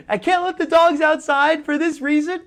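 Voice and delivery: high, loud imitation of her crying; hammed-up high-pitched distraught woman; mock tearful woman. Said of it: "to cry like that".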